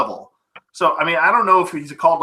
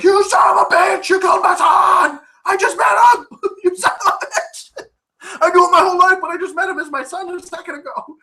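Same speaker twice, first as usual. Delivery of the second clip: furious yell. Retold or imitated. Kyle yelling at Arnold for killing his son, hammed-up furious shout. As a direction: scream it like a betrayed father.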